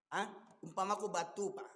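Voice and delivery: ordinary talk, nothing marked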